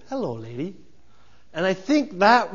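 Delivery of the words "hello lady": lightly voiced gentle giant, smiling; a hint of a happy simple giant, beaming; Andre the Giant. Preacher imitating the giant greeting the princess, smiling. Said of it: "he's so happy to see her".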